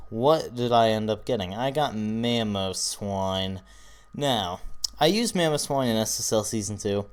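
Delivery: ordinary talk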